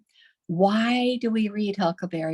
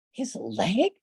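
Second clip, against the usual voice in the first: astonished; 5 semitones higher, much slower than usual. A narrator's reading. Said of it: "responded the astonished officer".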